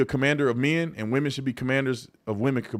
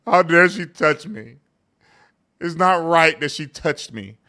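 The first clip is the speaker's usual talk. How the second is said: loud, slow, mocking indignation; mock a man crying foul over a touch; hammed-up outrage; 3 semitones higher, 6 dB louder, slower than usual. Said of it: "There's no".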